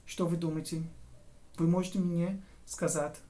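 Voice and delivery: ordinary talk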